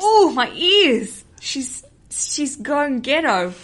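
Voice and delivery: loud, slow, drawn-out mock horror; high mock-pained complaint; appalled listeners. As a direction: wail in hammed-up dismay at an accent. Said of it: "People will be going".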